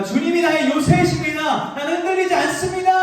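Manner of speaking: rising to a high, loud shout of faith; triumphant cry of confidence; proud declaration. Retold or imitated. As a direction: proclaim it boldly, like a victory song. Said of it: "라고 멋지게 노래하는데".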